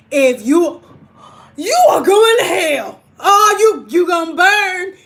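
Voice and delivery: high, loud, crying shout of damnation; mock hellfire preacher. Streamer mocking a preacher at viewers, loud crying shout. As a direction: yell it like a fire-and-brimstone preacher.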